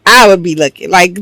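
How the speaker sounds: ordinary delivery, nothing marked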